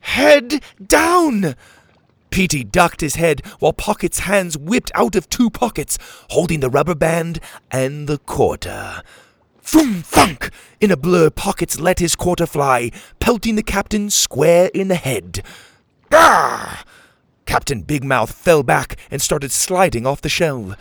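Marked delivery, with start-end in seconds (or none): 0.0-1.6 loud, high command; urgent shout. A narrator's reading. Hero shouts urgent warning. 9.6-10.5 loud, high thunking sound; imitated impact. A narrator's reading. Narrator voices shot striking. 16.1-16.8 loud, raised "gah"; cry of pain. A narrator's reading. Captain hit in head.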